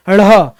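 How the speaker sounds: ढ़ flapped with an h breath; aspirated retroflex ढ़; aspirated sound demo. Shown as a demonstration. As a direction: flap ढ़ and release it with an h. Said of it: "एच वाला sound आएगा".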